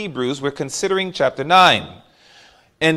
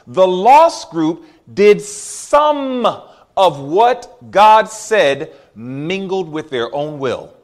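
slow emphatic point; much slower than usual. Real, not acted. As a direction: stress each word of the lost group. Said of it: "please notice this".

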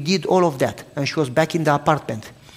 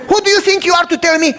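much higher, louder indignant imitation; mock outrage; 13 semitones higher, 7 dB louder than usual. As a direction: squeal the offended man's protest. Landlord outraged at pastor.

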